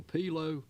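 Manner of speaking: "pilo" said like French, final T silent; French-style pronunciation. Shown as a demonstration. Manager explains a tax acronym to commissioners, "pilo" pronounced French-style. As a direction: say "pilo" as PEE-lo, dropping the T. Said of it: "They just pronounce it like the French".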